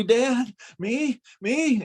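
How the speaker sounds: high, rising, startled little questions; squeaky innocent surprise. Retold or imitated. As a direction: play dumb, voice jumping up. Boy caught, feigns innocence.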